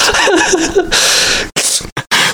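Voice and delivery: wheezing laugh; high breathless laugh. Real, not acted. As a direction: break up laughing. Laughter burst.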